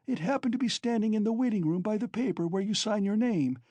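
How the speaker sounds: high, feverish widow's voice; distraught widow. Acted. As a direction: pour out her grievance frantically.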